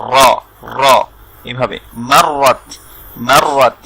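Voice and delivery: র with the tongue tip trilled against the palate. Shown as a demonstration. Teacher showing learners how to trill র. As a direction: roll the র in মারওয়াত. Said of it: "vibrate করেন".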